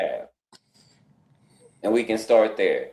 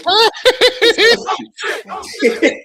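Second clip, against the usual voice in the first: high, loud burst of laughter; 7 dB louder than usual. Real, not acted. Laughing hard at Jeff's invite, high and loud. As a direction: crack up out loud.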